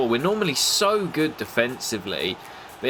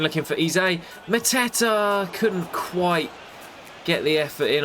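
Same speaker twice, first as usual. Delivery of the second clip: rising excitement; 8 semitones higher than usual. Real, not acted. Live chance, rising excitement.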